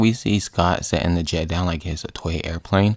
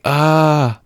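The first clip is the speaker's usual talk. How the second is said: high, loud drawn-out "ah"; mock falling scream; 8 semitones higher, 5 dB louder than usual. Rocket falling from the jet.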